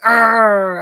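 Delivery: loud frustrated "arr"; mock angry growl at the screen. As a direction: growl out in exasperation. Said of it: "I was like".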